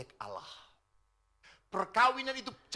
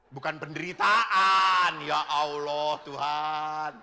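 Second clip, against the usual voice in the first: loud, slow ya Allah Tuhan; exasperated exclamation; 2 semitones lower, 10 dB louder, much slower than usual. Acted. Preacher exclaiming to congregation, slow loud exasperation. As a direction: groan it out to God.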